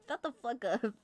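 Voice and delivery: usual speaking voice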